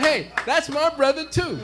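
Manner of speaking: loud, high joyful claim; excited agreement. Retold or imitated. Traveler matching the vendor's claim of brotherhood, excited. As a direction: top the other man's delight at full voice. Delighted shared recognition.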